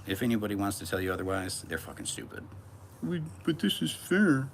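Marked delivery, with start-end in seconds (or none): 3.0-4.5 slow, very high, loud whine; hammed-up crybaby protest; mocking whine. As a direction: imitate a petulant complainer. Mimics whining about fairness.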